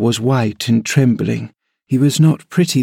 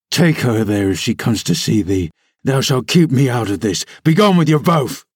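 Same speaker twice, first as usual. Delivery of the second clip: low, angry, rising at the end; furious; 3 semitones lower than usual. A narrator's reading. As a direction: order them out in a rage. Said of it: "his face looked swelled and furious".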